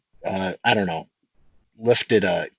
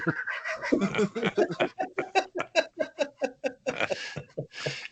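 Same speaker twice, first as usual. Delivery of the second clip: long, high, wheezing laugh that trails off; helpless laughter; 14 semitones higher, 8 dB quieter than usual. Real, not acted. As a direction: keep laughing at your own story. Laughing at the story's end.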